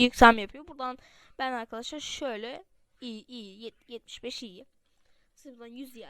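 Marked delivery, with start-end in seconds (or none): 0.0-0.7 loud burst as the level meter rises; suddenly loud. Shown as a demonstration. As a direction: jump in volume to show the meter peak. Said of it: "Bakın y". (birden yükselen yüksek ses)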